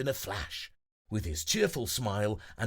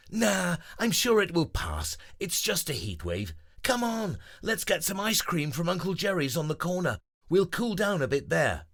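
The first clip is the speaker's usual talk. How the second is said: higher, bright buddy voice; cheerful otter; 8 semitones higher than usual. Acted. Grover reassuring friends, higher and upbeat. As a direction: brush it off and rally everyone.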